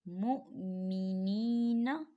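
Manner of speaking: मुमिनीना recited slowly, मीम joined to हमज़ा, वाव silent; slow recitation voice for मुमिनीना; Quranic recitation. Shown as a demonstration. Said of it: "मीम हमज़ा पेश मु".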